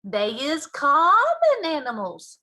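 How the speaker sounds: loud, slow and scornful giant; contemptuous. Acted. Giant scorning Sophie's ignorance, contemptuous. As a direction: sneer at her ignorance. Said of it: "said the B F G contemptuously".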